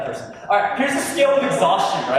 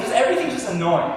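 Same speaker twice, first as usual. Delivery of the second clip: ordinary talk, nothing marked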